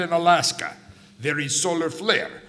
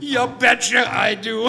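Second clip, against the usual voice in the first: louder laughing Russian-Minnesota mix; mock Minnesota accent; 5 semitones higher, 6 dB louder than usual. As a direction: shout back a mocking Minnesotan line. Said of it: "the funny accents".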